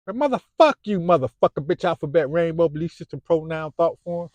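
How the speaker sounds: high, loud string of curses; furious cursing. Real, not acted. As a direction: spit the curses out in a yell. Angry cursing list.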